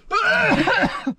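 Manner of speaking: hacking splutter; choking cough. Real, not acted. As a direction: cough to clear the throat. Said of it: "down wrong pipe".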